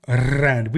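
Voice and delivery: "rand" with a long trilled R; drawn-out trill opening "rand"; strongly rolled R. Shown as a demonstration. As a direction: hold the roll on the R of "rand". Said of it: "we pronounce our R's".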